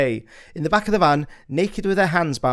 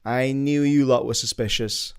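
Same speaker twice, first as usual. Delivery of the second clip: ordinary talk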